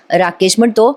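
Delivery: ordinary delivery, nothing marked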